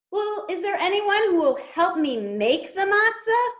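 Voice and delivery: little hen voice. Acted. Hen asks again for help.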